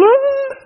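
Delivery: a sudden falsetto wail of a sob; mock high sob. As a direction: squeak out a hammed-up crying sob. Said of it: "just crying her eyes out".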